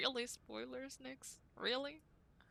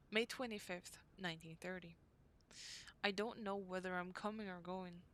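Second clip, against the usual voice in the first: diary reading; 4 dB quieter than usual. Acted. Reads diary entry from game.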